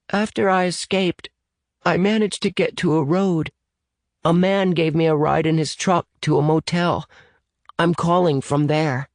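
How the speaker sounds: put-on high, young child's voice; shaken little boy. Boy tells rescuers where he went, high childlike voice. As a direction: pitch it well up, like a small boy. Phoenix recounts escape.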